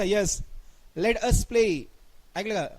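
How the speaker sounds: ordinary talk, nothing marked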